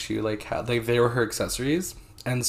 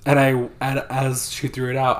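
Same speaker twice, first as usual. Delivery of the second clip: normal talking voice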